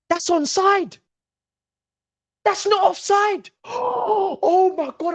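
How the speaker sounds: high-pitched shouts of disbelief; outraged protest. Real, not acted. Fan protesting a disallowed goal, outraged. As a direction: cry foul at the decision.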